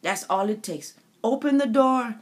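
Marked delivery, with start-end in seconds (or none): none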